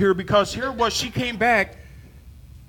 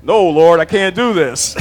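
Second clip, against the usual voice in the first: louder protest to God, pleading; mock protest; 3 semitones lower, 7 dB louder than usual. Preacher acting out refusing God, loud mock protest. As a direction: push back on the Lord, mock loud. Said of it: "My plate is full".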